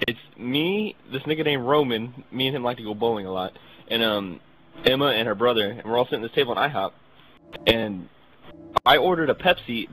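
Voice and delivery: ordinary talk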